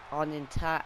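normal talking voice

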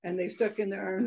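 usual speaking voice

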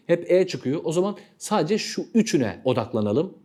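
normal talking voice

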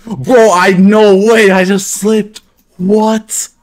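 high, loud disbelieving cry; shocked yell. Real, not acted. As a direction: yelp it out in shock. Just slipped in the game.

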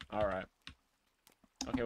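normal talking voice

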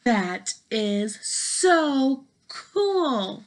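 slow, laughing delight. Acted. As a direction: savor it with a giggle. Piggy delighted at being read.

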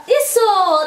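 cheerful praise. Real, not acted.

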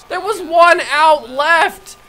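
high, slow, loud indignant cry; slow outraged wail. Real, not acted. Pulled pitcher protesting to nobody, loud slow wail. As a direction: wail it out in disbelief.

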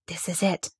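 softly breathed, hushed; hushed storybook reveal. A narrator's reading. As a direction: say it under your breath, softly. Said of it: "breathed Mary".

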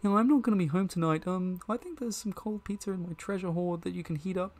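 game character. Acted. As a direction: lift the voice for a chatty creature.

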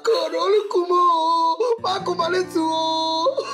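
long, loud drawn-out wail; lamenting. Acted. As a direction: howl in theatrical grief. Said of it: "you need to stop lamenting".

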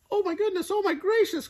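very high, squeaky chick voice; frantic Chicken Little. A narrator's reading. Chicken Little to the affronted turkey, high frantic squeak. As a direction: squeal it in a tiny panicked voice. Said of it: "croaked little".

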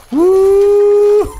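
high, loud whoop into giggle; high whoop and laugh. Real, not acted. Whoops at power-up.

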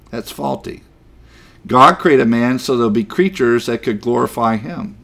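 ordinary delivery

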